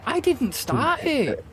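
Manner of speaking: high, slow whiny protest; high indignant protest. Real, not acted.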